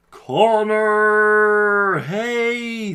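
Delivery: loud, drawn-out name greeting; big hearty shout of welcome; calling out. Real, not acted. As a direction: hail a friend who just dropped by. Greeting an arriving viewer by name.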